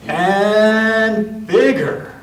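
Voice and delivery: and bigger, drawn out slowly; slow swelling emphasis on bigger; slow emphatic bigger. A narrator's reading. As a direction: stretch bigger to show growth. Story builds, slow emphatic.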